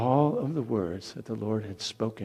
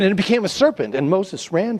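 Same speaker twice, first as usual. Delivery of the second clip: higher and louder, sudden alarm; excited narration; 11 semitones higher, 10 dB louder than usual. A narrator's reading. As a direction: tell it like the snake just sprang up. Serpent appears, Moses flees.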